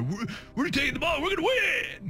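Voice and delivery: high, strained mock shout; imitated cocky player. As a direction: crow it like a player goading the crowd. Imitates an instigating football player.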